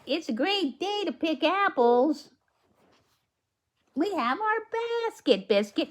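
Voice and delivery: put-on bright, high read-aloud voice for the little girl; high storybook girl.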